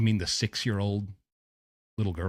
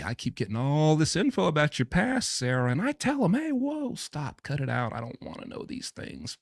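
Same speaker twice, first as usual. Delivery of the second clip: higher, lightly voiced mock of the father; mock paraphrase of him; 7 semitones higher than usual. Host paraphrasing the father's veiled threat in a mock voice. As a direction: play him innocently pretending to stop them. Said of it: "seems like a veiled threat".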